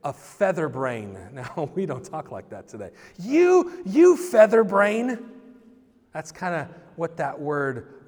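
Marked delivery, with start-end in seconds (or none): 3.2-5.3 high, loud, slow mock insult. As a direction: fling it like a playground taunt. Preacher acts out an old insult.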